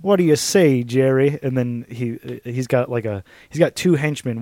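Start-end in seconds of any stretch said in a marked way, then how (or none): 0.0-1.4 put-on southern boss accent. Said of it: "southern accents".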